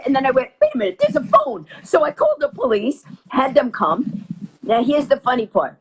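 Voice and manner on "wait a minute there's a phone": very high, quick burst of discovery; sudden realization. Retold or imitated. Victim counting in back room spots a phone, startled. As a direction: break off as it dawns on you.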